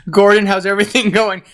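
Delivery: high, fast, laughing through; laughing question. Retold or imitated. Stella asking Gordon the ram in the kitchen, laughing. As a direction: ask it while cracking up. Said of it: "Stella asks Gordon".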